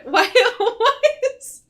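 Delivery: high, loud, laughing hard. Real, not acted. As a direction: get it out through laughter. Laughing at guest clapping.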